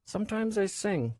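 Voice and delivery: high voice muffled by hands. A narrator's reading. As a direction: speak through hands over face. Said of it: "Her muffled voice came out".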